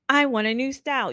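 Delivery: high, mock-bold demand; assertive regulator. Regulator client telling the stylist, assertive. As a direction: declare it as the bossy client. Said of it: "mirror their personality".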